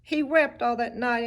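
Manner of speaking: ordinary delivery, nothing marked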